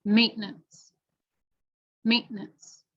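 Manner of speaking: "maintenance" with the T swallowed in the throat; American glottal T. Shown as a demonstration. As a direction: skip the T release. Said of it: "Last one".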